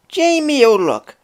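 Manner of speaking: high, loud Irish wife calling her husband; Irish accent, scolding. Acted. Wife scolding lazy Jamie in an Irish accent. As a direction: call out in an Irish brogue, long and loud. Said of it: "best attempt at an Irish accent whenever the characters speak".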